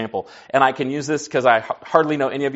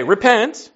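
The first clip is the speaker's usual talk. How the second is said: "repent" set apart, higher, with a rise at the end; stress on REPENT; 9 semitones higher, 4 dB louder than usual. Real, not acted. Preacher highlights a misunderstood word to the congregation, stress on "repent". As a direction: lift and emphasize the word "repent". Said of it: "I slow down and emphasize the word repent".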